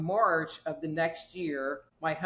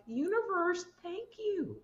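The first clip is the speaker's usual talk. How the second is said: grateful high voice; 14 semitones higher than usual. Retold or imitated. Speaker thanks the universe for the debt payoff, high and grateful. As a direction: lift it way up in gratitude.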